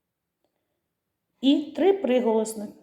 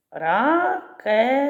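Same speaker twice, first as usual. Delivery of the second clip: "Ракета" split slowly into three syllables, pitched up; по складах; 5 semitones higher, 4 dB louder, much slower than usual. Shown as a demonstration. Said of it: "Скільки складів?". («Ракета» повільно, по складах, підвищеним голосом)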